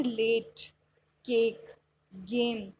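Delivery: लेट, केक, गेम one by one with long ay; long A sound. Shown as a demonstration. Teacher giving examples of a-e, long ay in लेट, केक, गेम. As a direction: model the ay vowel in लेट, केक, गेम, pausing between words. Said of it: "ए ई की sound में क्या होगा".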